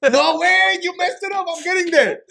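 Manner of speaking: high, loud frustrated outburst; exasperated yell. Retold or imitated. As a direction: yell at whoever broke the connection. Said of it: "And then".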